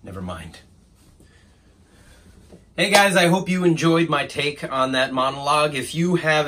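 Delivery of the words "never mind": very low, hushed delivery with long pauses; quiet resignation. Acted. Assistant giving up on his boss, quietly resigned. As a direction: give up on him softly.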